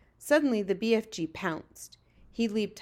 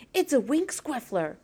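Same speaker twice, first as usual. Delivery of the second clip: excited, higher-pitched thrill; thrilled whisper; 5 semitones higher than usual. A narrator's reading. Giant identifies the dream he caught, thrilled. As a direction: let the thrill push the voice up. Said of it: "he whispered, with a thrill in his voice".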